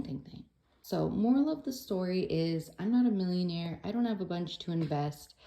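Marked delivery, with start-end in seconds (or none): 0.0-0.4 "thing, thing" dropped low and much softer; "thing, thing" trailing off to almost nothing; fading thing. Pretend. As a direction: let "thing, thing" fade away under the breath. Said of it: "Why not do the thing?".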